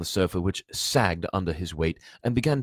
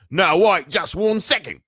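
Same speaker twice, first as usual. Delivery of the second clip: loud, raised-pitch outburst; angry giant; 12 semitones higher, 11 dB louder than usual. A narrator's reading. Giant leaps up in his anger, shouting. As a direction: call a furious halt. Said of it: "In his anger".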